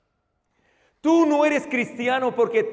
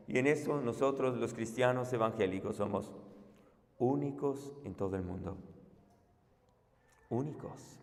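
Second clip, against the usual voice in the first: much softer and lower, intimate; hushed; 12 semitones lower, 17 dB quieter than usual. Real, not acted. Preacher confiding to congregation, hushed and low. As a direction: lean in and say it quietly.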